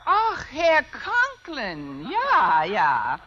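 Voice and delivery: drawn-out, effusive fake German greeting; loud, slow play-acted German accent; put-on German accent. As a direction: gush slowly in a mock German accent. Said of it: "If I can do a decent German accent".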